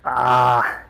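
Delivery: loud strained exhale mid-rep; straining grunt. Real, not acted. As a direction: push out a hard grunt under the weight. Straining on a heavy set.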